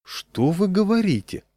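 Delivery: high mock astonishment; a hammed-up fake surprise; feigned surprise. A narrator's reading. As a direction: pretend to be amazed. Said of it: "притворно удивился он".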